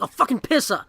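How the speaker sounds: imitating himself when really mad, lightly; mock mad. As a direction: curse it like something just went wrong. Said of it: "I only say it when I'm really mad".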